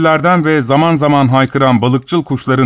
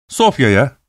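usual speaking voice

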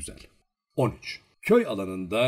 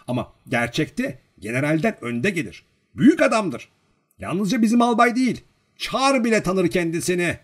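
higher bragging that swells louder; boastful; 8 semitones higher, 5 dB louder than usual. A narrator's reading. Servant impressing the girl with his master's rank, boastfully. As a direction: talk up your master's greatness. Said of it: "Vanyusha böbürlenerek söylüyordu bunları".

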